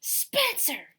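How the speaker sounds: loud, high exasperated yell of the name; fed-up mom yells for her son, loud and high. Acted. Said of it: "she yelled".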